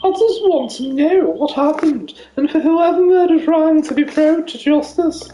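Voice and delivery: high posh lady voice. Pretend. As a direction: play a posh grieving widow. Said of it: "I'm gonna do another posh voice".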